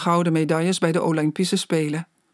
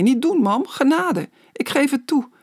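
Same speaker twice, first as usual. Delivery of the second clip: higher, mildly giggly pleading; giggling; 6 semitones higher than usual. A narrator's reading. Tickled boy gives in to his mother, giggling. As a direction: beg for mercy, playfully. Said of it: "giechelde hij".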